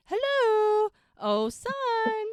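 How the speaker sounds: high, loud singsong holler; imitated sarcastic yodel; mock yodeling call. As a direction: call out into the distance, sustained and high.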